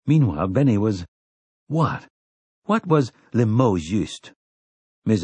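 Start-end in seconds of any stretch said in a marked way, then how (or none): none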